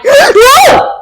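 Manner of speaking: sudden high-pitched shout, after a gasp; well-timed yell. Shown as a demonstration. Parent stopping dangerous behavior, sudden high yell. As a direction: demonstrate a parent's urgent, high yelp. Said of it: "A well-timed".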